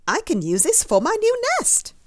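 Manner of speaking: high, loud, eager bird voice; chirping bird. A narrator's reading. Swoopy bird claiming the hat for its nest, chirping.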